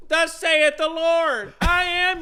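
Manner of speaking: booming, high, drawn-out church prophecy; mock prophet. Mimicking church prophecies to his co-hosts, mock-prophetic proclamation. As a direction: proclaim it loudly like an over-the-top prophet.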